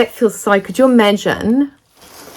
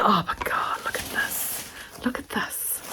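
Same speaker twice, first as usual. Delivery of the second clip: hushed, breathless whisper of wonder; awed whisper; 3 semitones higher, 11 dB quieter than usual. Real, not acted. Vlogger unwrapping a friend's fabric gift, whispering in awe. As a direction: whisper it like you can't believe it.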